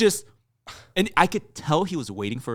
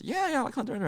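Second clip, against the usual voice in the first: a lightly voiced barista, pitched much higher; imitating the barista; much faster than usual. Barista agrees he likes Hunter x Hunter, in a high imitating voice. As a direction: mimic the eager fan, high and bright. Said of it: "And he goes".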